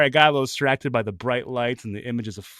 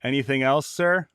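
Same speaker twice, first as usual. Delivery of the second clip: snarky. Retold or imitated. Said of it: "A snarky".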